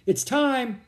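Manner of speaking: high, loud announcement; calling out. A narrator's reading. Captain alerting his men at the campfire, calling out. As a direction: relay the summons to the whole group.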